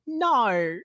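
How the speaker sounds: a slow Australian no that sounds like nor. Shown as a demonstration. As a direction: stretch the no the Australian way, slowly. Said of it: "My favorite thing to say in Australian is".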